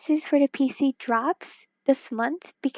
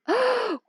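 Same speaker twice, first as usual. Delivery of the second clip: sharp gasp; 10 semitones higher than usual. Real, not acted. Reacts to chat message.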